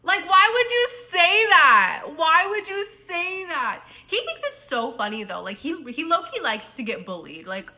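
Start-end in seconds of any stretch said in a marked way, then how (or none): 0.0-3.8 much louder, higher and slow, stretched out in disbelief; exasperated outburst. Real, not acted. Scolding herself for the dad joke, a loud slow wail. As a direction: cry out at her own blunder.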